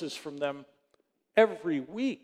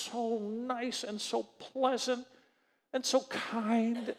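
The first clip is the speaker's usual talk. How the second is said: slow, softer, drawn-out praise; gushing niceness; 4 semitones higher, 7 dB quieter, slower than usual. Real, not acted. Pastor describing the nice girl on the phone, gushing slowly. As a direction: stretch each compliment out gently.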